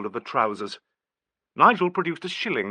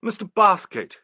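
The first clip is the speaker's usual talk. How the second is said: sudden exclamation; 9 semitones higher, 5 dB louder than usual. A narrator's reading. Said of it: "ejaculated the inspector".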